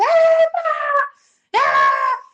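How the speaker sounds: high, loud yelp of alarm; mock startled shout. Mexican parent yelping as kids run under tables, mock startled shout. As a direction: yelp like a child just ran underfoot.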